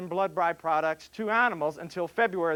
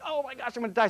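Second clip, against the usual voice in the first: mock panic; 7 semitones higher, faster than usual. Joke: man going off a cliff.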